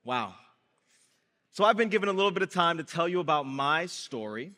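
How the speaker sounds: ordinary talk, nothing marked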